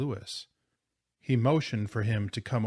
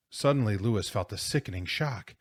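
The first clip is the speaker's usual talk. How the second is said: plain reading voice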